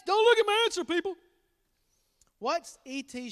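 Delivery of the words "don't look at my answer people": high, loud teasing warning; playful warning. Acted. Dad warning family off the answer. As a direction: tease them off the answer.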